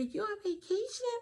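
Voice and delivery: put-on very high whiny kid question; a small child pleading in disbelief. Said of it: "And they be".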